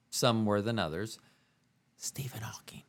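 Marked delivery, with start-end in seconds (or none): 2.0-2.8 hushed, voiceless whisper. Real, not acted. Host slipping in whom she enjoys most, whispered. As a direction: whisper it like a confidential aside. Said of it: "I needed to whisper that".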